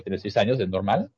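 ordinary talk

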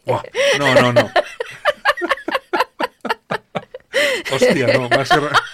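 squealing high laughs breaking through the words; helpless laughter. Real, not acted. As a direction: laugh so hard the words barely come out. Cracking up over Rajoy.

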